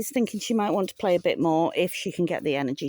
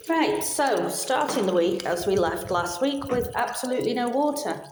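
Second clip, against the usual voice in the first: echoey, recorded in a corridor; 5 semitones higher, 4 dB quieter than usual. Real, not acted. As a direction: corridor echo round the voice. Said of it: "if I sound a bit echoey, it's because I'm in a corridor".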